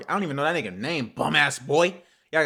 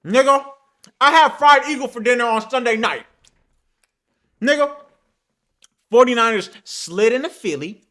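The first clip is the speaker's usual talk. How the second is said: high, loud victory crowing; gloating shout; 8 semitones higher, 7 dB louder than usual. Real, not acted. Forty-niners fan crowing over fried eagle, gloating shout. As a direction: shout it high and hard, milking the win.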